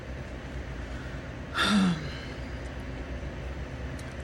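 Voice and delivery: soft, drawn-out sigh; real low sigh; long sigh. Real, not acted. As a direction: let out a long, quiet breath. Long pause before resuming the rant.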